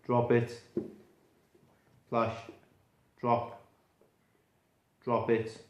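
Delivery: calm, firm dog command. Shown as a demonstration. Trainer telling the puppy to drop the toy, firm and low. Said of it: "Then I'm gonna change my tone of voice".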